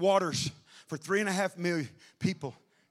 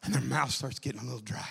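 much softer, lower sermon voice; hushed preaching; 6 semitones lower, 8 dB quieter than usual. Acted. Preacher quietly drawing the congregation in. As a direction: drop the voice low and intimate.

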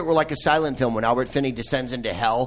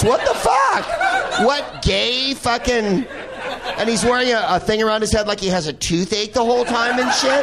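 high, slow, laughing what the fuck; laughing disbelief; 8 semitones higher than usual. Real, not acted. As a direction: squeal it out through laughter. Laughing outrage at the performance.

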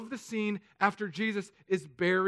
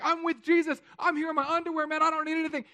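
much higher and louder, thrilled and carefree; giddy naked guy; 11 semitones higher, 5 dB louder, faster than usual. Acted. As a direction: squeal it like a kid who thinks he's got it made. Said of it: "when you're like".

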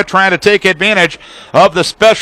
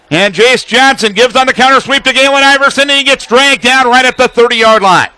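usual speaking voice

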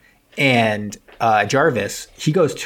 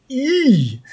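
high squeal; 14 semitones higher than usual. Pretend. Gleeful squeal ending a joke.

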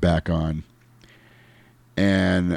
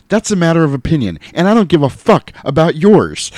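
quick, higher, louder movie retort; George Clooney; 7 semitones higher, 5 dB louder than usual. Retold or imitated. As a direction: deliver it as an emphatic film quote. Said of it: "this is another line I used to use".

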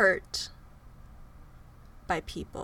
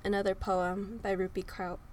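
ordinary talk

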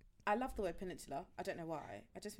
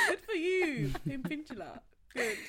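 higher, louder cheerful praise; mock-proud mum; 7 semitones higher, 9 dB louder, slower than usual. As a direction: cheer him on sweetly. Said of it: "And I'm like".

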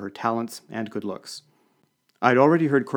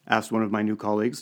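plain reading voice

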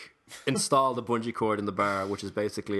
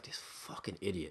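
under-the-breath whispered insult; whispered annoyed aside; muttered aside; 7 semitones lower, 13 dB quieter, slower than usual. Retold or imitated. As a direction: hiss it to yourself, barely voiced. Muttered aside in retold story.

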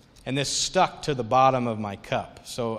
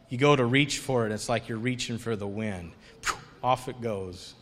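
ordinary talk

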